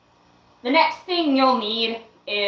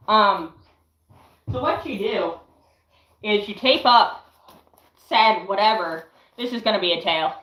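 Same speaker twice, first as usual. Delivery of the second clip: normal talking voice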